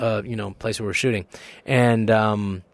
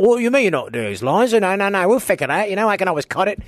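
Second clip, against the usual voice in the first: higher, louder flustered director; nervous director; 10 semitones higher, 5 dB louder than usual. Retold or imitated. Director calming the actor over lines, higher flustered voice. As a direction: placate the actor in a rush.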